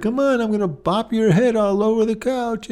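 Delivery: high, smiling pet talk; cheery high doggy voice; sweet coaxing tone. Acted. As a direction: lure the dog with a friendly lilt. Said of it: "We'd smile and just beckon it".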